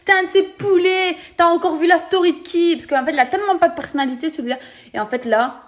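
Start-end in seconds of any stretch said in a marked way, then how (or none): 0.0-2.7 high, loud, fast mocking rant; mocking tirade. Retelling how she mocked his fake Marseille accent, loud and scornful. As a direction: tear into him, faster and louder. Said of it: "je fais".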